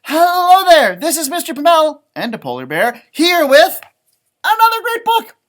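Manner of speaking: a cheerful put-on host voice opening the show; mock show host intro. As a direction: greet the camera like a showy presenter. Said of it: "but I also could say".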